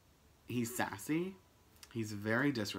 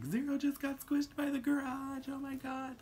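much higher, louder panicked retelling; freaking out; 5 dB louder than usual. Retold or imitated. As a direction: blurt it out in panic. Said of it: "Like, freaking out".

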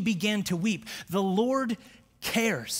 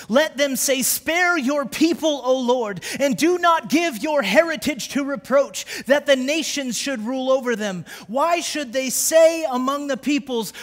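higher and louder, a pleading cry to the congregation; preaching at full force; 5 semitones higher, 6 dB louder than usual. Acted. Preacher voicing priests who plead, building to a shout. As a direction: pastor reading Joel to the church, crying out at full force.

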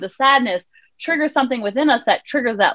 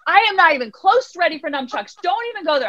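put-on stubborn child; 6 semitones higher than usual. Son refusing, voiced by mother.

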